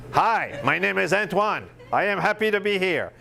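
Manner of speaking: a stiff, rehearsed camera greeting; stiff, uptight intro. Retold or imitated. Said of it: "people get really tight".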